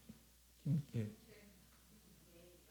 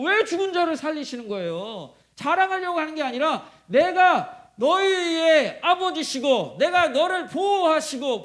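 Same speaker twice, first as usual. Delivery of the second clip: high, loud pulpit proclamation; impassioned preacher building a case; full-force preaching; 8 semitones higher, 9 dB louder than usual. Real, not acted. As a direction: drive each phrase home with raised pitch and volume.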